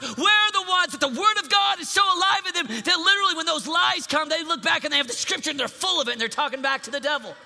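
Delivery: loud, high, fast shouting; full force preaching. Acted. Preacher shouting at teens to know scripture. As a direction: drive the point home hard.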